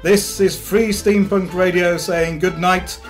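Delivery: ordinary talk, nothing marked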